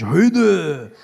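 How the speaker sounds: usual speaking voice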